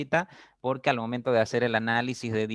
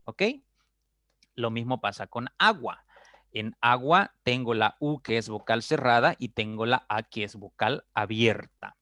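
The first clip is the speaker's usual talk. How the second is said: usual speaking voice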